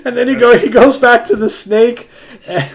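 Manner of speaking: high, loud, breaking into laughter; can't keep a straight face retelling it; laughing while telling. Real, not acted. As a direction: friend cracking up while retelling a comic plot. Teller laughing mid-retelling.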